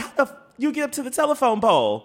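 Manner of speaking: high, loud disbelief; can't believe anyone climbed up there; amazed. Real, not acted. To her co-host, high and loud in amazed disbelief.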